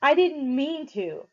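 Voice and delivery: slow, loud, high mimicking shout; mocking shout. A narrator's reading. Derry mocking Pony's excuse, shouting. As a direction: throw his words back at him. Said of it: "Derry shouted".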